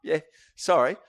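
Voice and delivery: sudden loud sheepish apology. Retold or imitated. Workmate caught out by the comic, loud sorry. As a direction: blurt a loud embarrassed sorry. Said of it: "And he goes".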